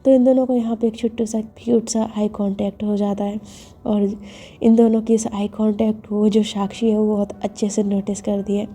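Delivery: usual speaking voice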